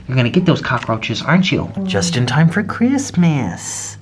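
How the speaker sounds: ordinary talk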